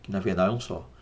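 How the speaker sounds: usual speaking voice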